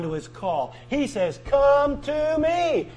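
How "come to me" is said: drawn out high and slow, pleading; tender plea. Acted. Preacher voicing Jesus inviting sinners, slow and high. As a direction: stretch it out like a gentle invitation.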